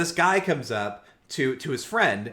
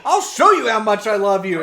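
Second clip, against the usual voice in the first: raised, louder mock insistence; hammed-up desperate vow; 4 semitones higher, 6 dB louder than usual. Boyfriend proves love to girlfriend, mock desperate. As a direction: push it like a melodramatic lover.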